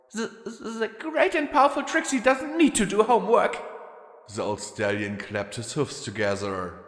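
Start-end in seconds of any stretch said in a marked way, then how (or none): 0.1-3.6 high, fast, quavering boast. A narrator's reading. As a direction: puff up but let it wobble. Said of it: "declared in a quavering voice".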